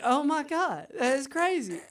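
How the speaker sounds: high-pitched, wowed imitation; hammed-up awe at a wild fact; mock amazement. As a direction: gush like a gullible listener. Voicing gullible people's amazed reaction.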